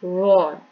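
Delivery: वॉर said loud and full, mouth puffed; heavy puffed letter; heavy Arabic letter. Shown as a demonstration. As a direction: fill the mouth with air on वॉर. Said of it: "सबसे मुश्किल वाला word है".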